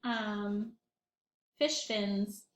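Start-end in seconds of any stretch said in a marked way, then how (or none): none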